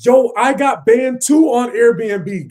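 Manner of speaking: loud, slow, stretched-out yell; excited shout. Real, not acted. Reacting to his cohost's news, a loud slow shout. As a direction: blurt it out, drawing each word.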